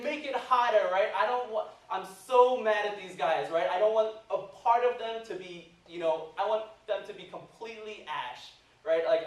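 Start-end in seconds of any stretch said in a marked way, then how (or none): 0.0-0.9 high, loud angry order; raised-voice furious demand; screaming king. Acted. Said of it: "he's screaming". 1.2-3.5 loud, high-pitched ranting; heated, raised-voice outburst; mad king. Acted. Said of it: "he's just mad". 3.7-8.5 acted royal fury, voice raised; mad king. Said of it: "he's just mad".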